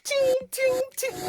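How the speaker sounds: high, loud ting ting sound; hurried ting ting. Retold or imitated. Mocking busy Londoners, high quick ting ting. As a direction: chirp it like everyone's dashing past. Mimicking Londoners rushing about.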